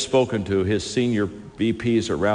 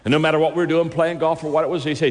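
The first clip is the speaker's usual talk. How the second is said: ordinary talk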